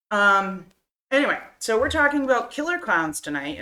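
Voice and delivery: ordinary talk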